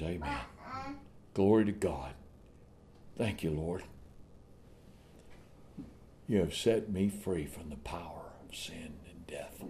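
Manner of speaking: soft, low prayer of thanks; softly giving thanks to God; hushed thanksgiving. Acted. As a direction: drop to a quiet, reverent murmur. Quiet thanks to God amid preaching.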